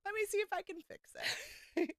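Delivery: high, fast ditzy squeal; airheaded fixer voice. Retold or imitated. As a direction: sing it like a naive dreamer. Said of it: "I'm like".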